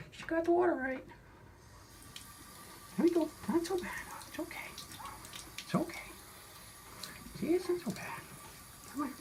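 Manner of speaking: soft high coaxing pet talk; soothing a scared cat. Real, not acted. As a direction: hush and reassure a frightened kitty. Bathing a reluctant cat.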